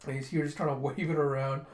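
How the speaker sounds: ordinary delivery, nothing marked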